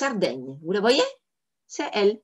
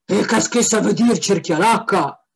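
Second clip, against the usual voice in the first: louder gruff puzzled devil; third devil; 5 dB louder than usual. Acted. Third devil asks about the strange word, loud and gruff. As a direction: growl the question, suspicious. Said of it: "conclut la troisième".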